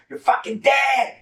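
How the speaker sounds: slow, high, loud threat shouted at intruders; furious yell. Retold or imitated. Yelling at the intruders to scare them, a slow high furious shout. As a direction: yell it like you mean to kill them. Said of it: "where I'm yelling at these fools".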